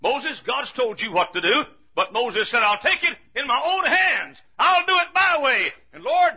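high, loud preacher's shout; fiery, dramatized sermon cry; full-force preaching. Acted. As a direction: act out headstrong Moses at pulpit volume. Preaches, acting out headstrong Moses.

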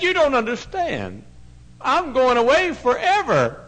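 put-on high, loud, slow child's wail; heartbroken child. Girl telling the preacher she leaves forever, high wailing. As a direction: cry it out like a heartbroken little girl.

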